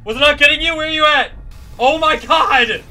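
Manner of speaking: high, loud exasperated panic; frantic shouting. Real, not acted. Player unable to find the attacker, frantic shouting. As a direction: shout in mounting disbelief, drawing out the end.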